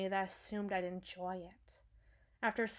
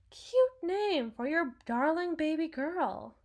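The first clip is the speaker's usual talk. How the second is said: high, lilting mock cutesy voice; mock-sweet; 6 semitones higher, 3 dB louder than usual. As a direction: coo it sarcastically, pitch swooping up. Mocks baby-name website wording.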